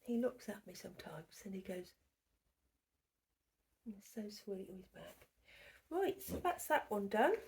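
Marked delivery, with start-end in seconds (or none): 0.0-5.3 hushed fond whisper. Real, not acted. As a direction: whisper it softly. Hushed aside about him.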